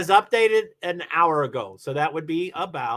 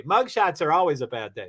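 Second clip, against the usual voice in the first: ordinary delivery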